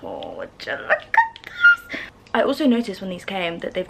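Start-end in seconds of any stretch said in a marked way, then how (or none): 0.0-1.0 slow breathy whisper of awe; awed whisper. Real, not acted. Awed by the shoes. 1.4-2.0 very high squeal on one word; excited squeal. Real, not acted. Awed by the shoes.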